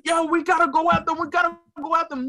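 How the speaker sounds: high, urgent mob voice; riled-up mob. Retold or imitated. Everybody in the madness, high and riled up.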